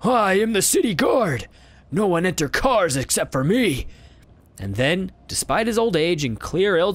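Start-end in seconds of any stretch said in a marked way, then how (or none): 0.0-3.9 put-on old beggar voice. Beggar claims guard.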